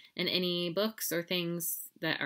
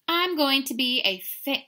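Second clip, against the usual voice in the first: started out loud; 8 semitones higher, 7 dB louder than usual. Acted. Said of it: "oh, he's whispering".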